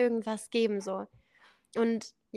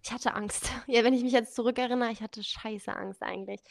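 ordinary delivery

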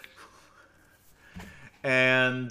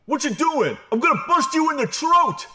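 high, loud imitation of an angry driver; mock road-rage shout; retold yell; 8 dB louder than usual. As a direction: yell like a furious New Yorker out a car window. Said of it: "Yells out the window".

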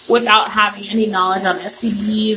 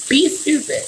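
pitched-up repeat; 6 semitones higher than usual. Real, not acted. Daring listeners to be stupid, pitched higher. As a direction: lift it higher and hang on it.

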